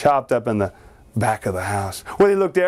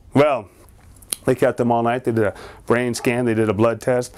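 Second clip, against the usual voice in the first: plain reading voice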